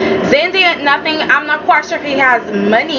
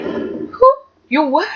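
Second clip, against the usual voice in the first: feigned non-recognition; 3 semitones higher, slower than usual. Pretend. Acts daughter snubbing returning father.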